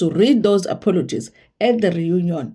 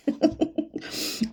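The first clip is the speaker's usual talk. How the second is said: breathy laugh; 9 semitones higher, 9 dB quieter than usual. Real, not acted. Laughs at own refusal.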